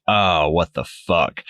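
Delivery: slow, drawn-out retold disbelief; mock dismay. Retelling Rachel's reaction to the panic room, slow mock dismay.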